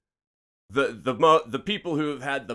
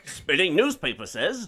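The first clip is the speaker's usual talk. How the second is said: raised, loud Mid-Atlantic newsman bit; Mid-Atlantic accent; 6 semitones higher, 6 dB louder than usual. Acted. Joking about every old movie, loud mock Mid-Atlantic accent. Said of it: "they had the Mid-Atlantic accent".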